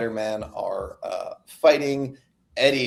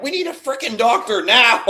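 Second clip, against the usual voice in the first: urgent demand; 12 semitones higher, 6 dB louder than usual. Retold or imitated. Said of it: "And Dylan's like".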